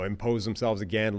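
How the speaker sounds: ordinary talk, nothing marked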